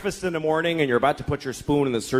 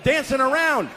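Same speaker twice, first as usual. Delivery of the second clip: manic exasperated rant; 10 semitones higher than usual. Retold or imitated. Escalating exasperated answers to child.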